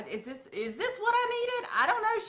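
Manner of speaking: a hint of a bewildered customer at home; confused customer. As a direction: play an overwhelmed client doubting her purchase.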